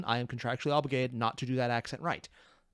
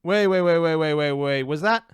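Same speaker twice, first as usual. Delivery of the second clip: louder, rapid-fire waits, cutting in on the clip; sudden alarm; 8 dB louder than usual. Real, not acted. Reacting aloud to the clip, startled and loud. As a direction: stop everything, something just caught you.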